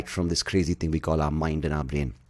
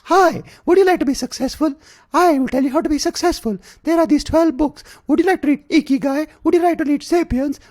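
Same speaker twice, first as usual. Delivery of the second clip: much higher, louder squeaky pitchman parody; mocking ad guy voice; 6 dB louder than usual. Mocking a smug YouTube ad guy's high pitch. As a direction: ham up the smug self-help guy. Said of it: "he sounds exactly like that, the guy with the mustache".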